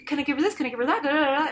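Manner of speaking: a nurse begging for orders; anxious nurse. Retold or imitated.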